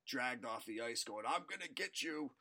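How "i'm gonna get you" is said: Wicked Witch voice. Retold or imitated. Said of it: "He sounds like the Wicked Witch".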